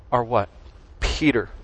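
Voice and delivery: usual speaking voice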